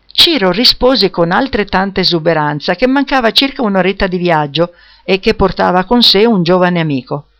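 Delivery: ordinary delivery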